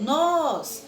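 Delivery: "Nós" with a loud, high, open O; teacher modelling the open O in "Nós"; open stressed O. Shown as a demonstration. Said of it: "acento agudo na letrinha O".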